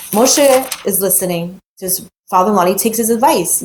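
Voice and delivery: ordinary talk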